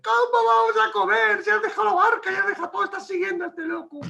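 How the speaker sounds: mother-in-law nagging about her husband leaving the boat, high and whiny; complaining wife. Acted. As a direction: squeal it like an exasperated wife. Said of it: "Diría la mujer".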